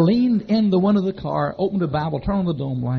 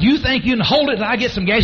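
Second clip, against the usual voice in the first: loud urgent plea; 8 semitones higher, 6 dB louder than usual. Retold or imitated. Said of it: "I said, I said".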